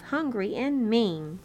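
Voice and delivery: ordinary delivery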